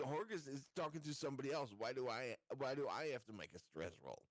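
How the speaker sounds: ordinary talk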